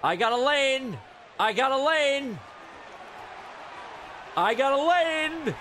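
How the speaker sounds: high-pitched repeated call, last one stretched; excited call. Real, not acted. Gamer spotting an opening in play, calling excitedly. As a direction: call it out high, drawing out the final word.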